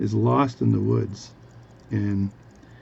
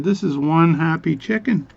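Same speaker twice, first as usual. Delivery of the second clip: raised, loud acted enthusiasm; eager tall-tale teller; 6 semitones higher, 5 dB louder than usual. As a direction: proclaim it excitedly, pitched up. Guy eagerly reports chicken's happiness.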